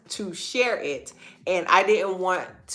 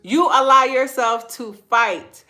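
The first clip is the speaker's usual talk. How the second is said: louder and higher, driving it home; preaching emphasis; 6 semitones higher, 6 dB louder than usual. Real, not acted. Preaching build-up, repeated for emphasis.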